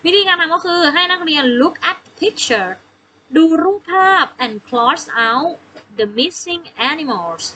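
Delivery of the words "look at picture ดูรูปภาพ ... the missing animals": teacher reading English slowly; slow read instruction. Shown as a demonstration. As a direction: look at picture read slowly for pupils. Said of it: "หมายความว่า".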